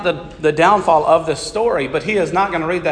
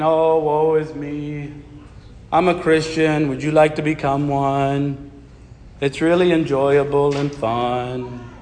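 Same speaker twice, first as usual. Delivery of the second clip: gloomy Eeyore voice; 4 dB quieter, slower than usual. Pretend. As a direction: sigh it out like a glum donkey.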